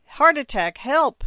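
high, loud alarm; distressed cry. A narrator's reading. Wife over her fallen husband, panicked cry for help. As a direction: shout desperately for help. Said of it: "his wife wept".

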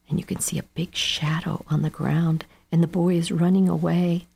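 plain reading voice

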